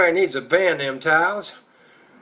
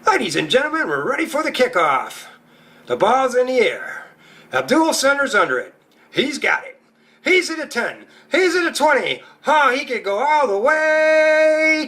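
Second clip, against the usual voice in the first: much higher, building sports announcer call; sportscaster; 7 semitones higher than usual. Acted. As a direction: call the run like a TV play-by-play man, rising to the big finish. Mock sports broadcast of kickoff.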